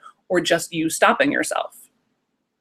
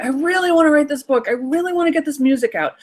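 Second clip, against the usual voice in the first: put-on high, longing kid voice; lightly voiced child, wanting it badly; eager inner child; 9 semitones higher than usual. Inner nine-year-old telling the parent, high eager kid voice. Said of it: "your inner nine-year-old is saying".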